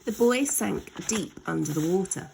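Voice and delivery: ordinary delivery, nothing marked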